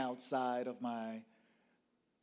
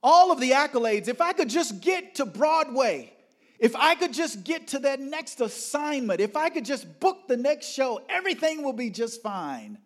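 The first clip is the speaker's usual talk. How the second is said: high, loud, urgent pleading; high-pitched, full-voiced yearning; fervent striving; 12 semitones higher, 11 dB louder than usual. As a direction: belt out your hopes in a driven rush. Voicing her past desperate striving.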